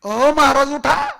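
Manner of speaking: high, loud wake-up holler; calling out. Acted. Messenger waking the sleeping Maharaj, urgent shout.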